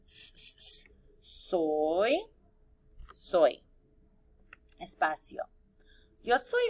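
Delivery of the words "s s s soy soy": "S, s, s, soy" drawn out from the lone hiss of the S into the word; sounding out. Shown as a demonstration. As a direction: stretch the s sound, then land on "soy". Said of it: "Mayúscula S para empezar". (alargando la ese: "s, s, soy")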